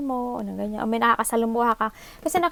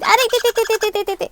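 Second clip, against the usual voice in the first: loud, high yelp of pain; real ouch, loud and high; 8 semitones higher, 6 dB louder, faster than usual. Real, not acted. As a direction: cry out as you get hurt. Child hurts her, she yelps.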